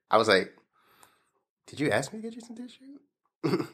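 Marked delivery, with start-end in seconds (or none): 1.7-3.7 incredulous high question. Retold or imitated.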